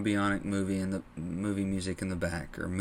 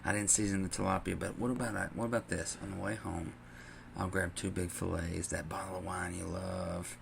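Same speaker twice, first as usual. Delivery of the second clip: soft, hushed apologetic pleading; mock a husband soothing his wife; quiet tender apology to his wife on the phone; sweet-talking apology; 7 dB quieter than usual. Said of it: "He's like".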